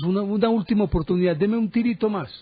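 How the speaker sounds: high-pitched retold begging; mock pleading. Retelling Carter begging his patron.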